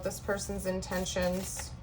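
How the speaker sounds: soft murmured question to the cards; quiet murmur. Real, not acted. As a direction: murmur it under your breath. Asking the cards while shuffling.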